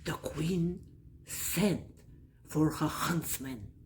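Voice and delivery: plain reading voice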